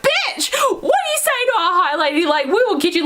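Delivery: high-pitched mock indignation; hammed-up offended telling-off, pitched high; sassy outrage. Everyone leaping to their high lady's defence, mock outrage. Everyone scolds Amren, acted quote.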